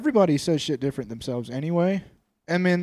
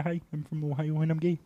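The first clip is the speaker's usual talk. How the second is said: softer, mock Ohioan accent; 5 dB quieter than usual. Answering what Ohioans sound like, mock Ohioan accent. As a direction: put on a silly Ohioan accent. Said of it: "What's the Ohioan accent?".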